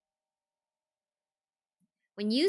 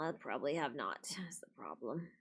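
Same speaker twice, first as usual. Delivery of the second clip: whispered aside to herself; whispering; 5 semitones lower, 10 dB quieter than usual. Real, not acted. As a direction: drop to a whisper. Muttered aside while playing.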